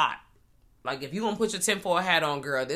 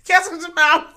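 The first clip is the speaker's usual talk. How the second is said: high, loud mock command; 13 semitones higher, 12 dB louder than usual. Real, not acted. Joking mockery, mid-laughter.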